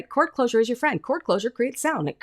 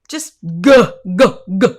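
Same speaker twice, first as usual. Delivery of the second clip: short punchy g sounds, louder; strong glottal g; 3 semitones lower, 9 dB louder than usual. Shown as a demonstration. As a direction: bark out hard g's to feel the cords close. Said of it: "you can feel that muscle closing those cords".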